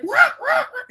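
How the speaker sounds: mock high-pitched shouts of what; rapid-fire startled yelps, imitating family; alarmed questioning. Family reacting to her running out, alarmed shouting.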